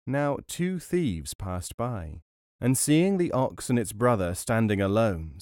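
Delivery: ordinary delivery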